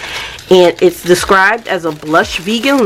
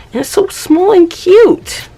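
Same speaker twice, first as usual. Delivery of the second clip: much higher, squealing over something tiny; high delighted squeal over the tiny journal; cutesy gush; 13 semitones higher than usual. Real, not acted.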